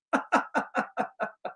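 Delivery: soft, voiceless wheezing laugh; helpless laugh. Real, not acted. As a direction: laugh too hard to get the words out. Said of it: "cannot even say it without laughing".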